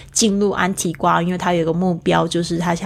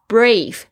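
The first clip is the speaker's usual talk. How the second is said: Brave said alone, louder and firmly stressed; emphasized word; 4 semitones higher, 5 dB louder than usual. Shown as a demonstration. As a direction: hit the word Brave clearly. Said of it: "B R A V E". (重读Brave)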